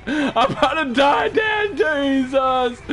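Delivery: high, slow desperate yell; real terrified shout; drawn-out panic shout. Real, not acted. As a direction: cry out like it's the end. About to die, calling Dad.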